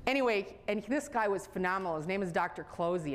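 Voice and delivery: ordinary talk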